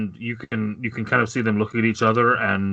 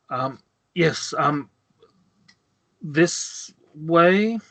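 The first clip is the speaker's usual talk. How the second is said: nervous servant; 6 semitones higher, much slower than usual. Acted. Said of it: "he kind of clears the throat and he says".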